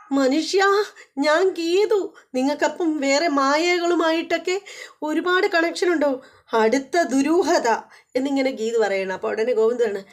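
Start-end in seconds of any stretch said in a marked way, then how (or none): 0.1-7.7 mock scolding wife. Geethu scolds husband.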